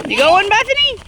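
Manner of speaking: high, loud, thrilled call to the sledder; high excited holler at the sled run. Real, not acted. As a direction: cheer her on from the hill. Excited calling to sledding child.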